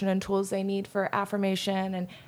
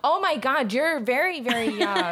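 mock surprise; 5 semitones higher, 3 dB louder than usual. Real, not acted. Mock shock at age.

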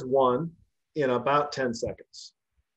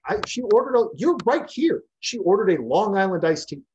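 much higher, defensive and indignant; flustered protest; 7 semitones higher than usual. Retold or imitated. Bartender protesting to the angry husband, high and flustered. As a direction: pitch jumps up as he pleads his innocence.

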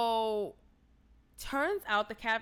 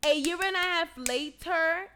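higher, louder, rising disbelief; incredulous; 7 semitones higher, 6 dB louder than usual. Retold or imitated. Viewer questioning the late confession, incredulous. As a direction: throw it back as an outraged question.

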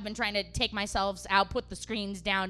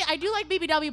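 ordinary talk

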